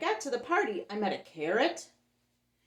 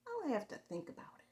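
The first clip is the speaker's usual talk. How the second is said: whispered to herself; 6 semitones higher, 6 dB quieter than usual. Acted.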